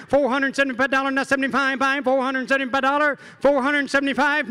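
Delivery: rapid rhythmic auction chant; auctioneer. Acted. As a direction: rattle off bids like an auctioneer. Auctioneer calling bids.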